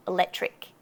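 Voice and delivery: "electric" said lazily as e-LET-ric; dropped C. Shown as a demonstration. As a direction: skip the middle k in "electric". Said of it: "the C in this one too".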